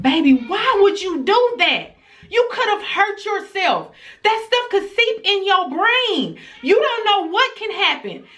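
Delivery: high, pleading mother's reprimand; alarmed scolding. Retold or imitated. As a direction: scold your child out of fear for her. Retelling scolding crying daughter.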